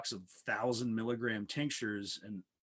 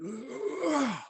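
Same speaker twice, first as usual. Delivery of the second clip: high, loud effortful grunt breaking the clamps; strained grunt; 13 semitones higher, 4 dB louder than usual. Retold or imitated. Patient straining against wrist clamps, loud grunt. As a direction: grunt as you snap the restraint. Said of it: "I just went".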